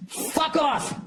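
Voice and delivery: sudden high, strained burst of rage; angry outburst. Real, not acted. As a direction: spit it out in fury at the teller. Ranting about the bank teller.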